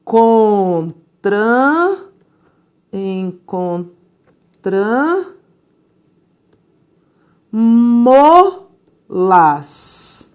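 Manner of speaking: encontram-las drawn out syllable by syllable; slow syllable demo. Shown as a demonstration. Teacher slowly sounding out the pronoun form for students. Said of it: "Vou substituir as amigas pelo pronome as".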